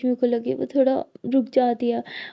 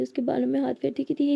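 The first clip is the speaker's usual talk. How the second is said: ordinary delivery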